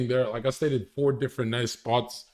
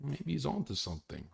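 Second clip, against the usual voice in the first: very soft, muted musing; understated reaction; 4 semitones lower, 14 dB quieter than usual. Shown as a demonstration. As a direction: demonstrate a low-key nod of agreement. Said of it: "don't light up when you see my opinions. Just be like".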